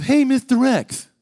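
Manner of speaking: lightly voiced teenage boy, slow and eager; boy with a lisp. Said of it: "Had a little bit of lisp and a little bit of, like, nasal stuff".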